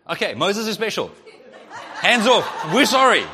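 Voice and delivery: high, loud, laughing plea; laughing panicked apology. Acted. Aaron and Miriam to God, hammed-up laughing apology. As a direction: backpedal in a hurry, laughing.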